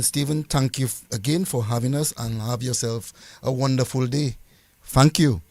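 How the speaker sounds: ordinary talk, nothing marked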